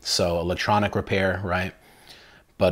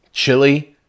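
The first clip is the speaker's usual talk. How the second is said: loud, high burst of hype; excited; 8 semitones higher, 9 dB louder than usual. Real, not acted. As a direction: sell the word like a showman. Hyping the dish.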